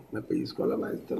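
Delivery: put-on whispered small child's complaint; whispering child. Son tells dad the teacher pinched him, whispered. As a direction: whisper it like a hurt little boy. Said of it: "mi hijo llegó y me dijo".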